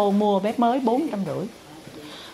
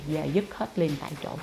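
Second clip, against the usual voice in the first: ordinary talk, nothing marked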